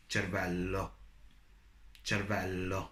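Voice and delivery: "Cervello" with both L's held long; "cervello" as cher-VEL-lo, the L lengthened before the final o; double L. Shown as a demonstration. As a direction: draw out the LL in "cervello". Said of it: "there are two L's".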